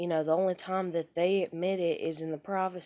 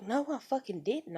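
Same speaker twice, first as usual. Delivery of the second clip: higher-pitched mock argument retort; retold indignant denial; 6 semitones higher than usual. Woman retells denying husband's accusation, indignant. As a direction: replay an indignant comeback, not shouted.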